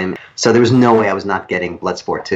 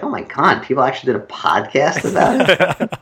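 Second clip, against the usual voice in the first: mock disbelief; 6 semitones higher than usual. Writer mocking his podcast hosts with incredulous disbelief. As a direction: act amazed that anyone made a podcast about it. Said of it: "I was like".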